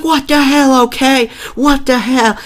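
high, loud mock outrage; hammed-up high freakout; imitating Markiplier. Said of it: "He's like".

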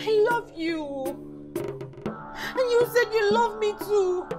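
slow, wounded plea, close to crying; tearful reproach. Acted. Hurt woman pleads with boyfriend.

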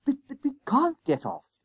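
high, stammered schoolboy plea; desperate pleading. A narrator's reading. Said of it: "urged Jennings desperately".

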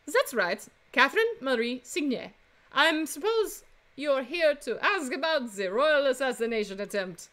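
put-on Frenchwoman's French accent; female French accent. Flower seller answering a defense attorney, female French accent. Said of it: "do another female French accent".

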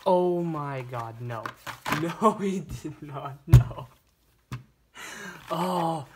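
loud, slow, disbelieving cry; real shock turning into a shout; stunned excited outburst. Real, not acted. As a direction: let it burst out, then peak on the last no. Stunned by the car inside.

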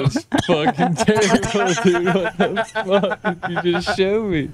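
high, stunned outburst; shocked disbelief. Real, not acted.

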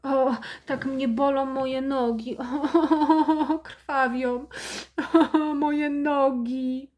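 milk the suffering, half laughing; mother fakes agony over her bleeding legs, hammed up; hammed-up moaning. Martyr mother moaning theatrically.

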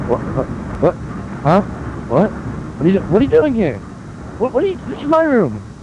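high, startled what-are-you-doing; shocked outrage. Acted. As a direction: squeak it out in disbelief. Caught intruder in his room.

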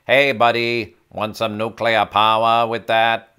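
loud, slowed Boston server; hammed-up Boston accent with dropped r's; strong Boston accent. Imitating a Boston ice cream server to a customer, thick Boston accent. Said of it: "their accent was so strong".

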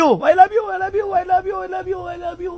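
high repeats fading to near silence; digital echo. Shown as a demonstration. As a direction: echo dying into the distance. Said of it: "Ganun ang echo eh".

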